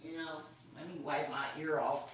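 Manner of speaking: hushed aside mid-task; quiet aside. Real, not acted. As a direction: drop the voice right down, an offhand aside. Aside while wiping the phone.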